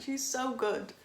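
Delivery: ordinary delivery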